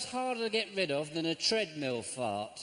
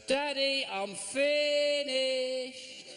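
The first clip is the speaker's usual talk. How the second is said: put-on high, drawn-out kid's call; little child voice; 11 semitones higher, 3 dB louder, much slower than usual. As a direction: call out like a small child from another room. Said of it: "I heard a little voice go".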